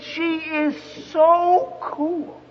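high, very drawn-out mock swooning; smitten son. Son raving to dad about his new girlfriend, swooning. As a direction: stretch each word in dreamy, high-pitched awe.

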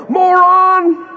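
drawn-out, high-pitched bellow; imitated coach's yell. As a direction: bellow the insult like a coach in a player's face. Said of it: "looking out the ear hole and yell".

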